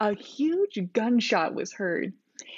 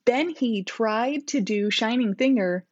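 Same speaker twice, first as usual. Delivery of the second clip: plain reading voice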